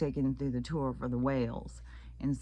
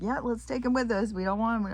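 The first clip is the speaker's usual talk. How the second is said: higher, breezy mock voice; mock casual guide; 9 semitones higher, 4 dB louder than usual. Tour guide mockingly keeping the seal. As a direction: shrug it off cheerfully. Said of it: "he's like".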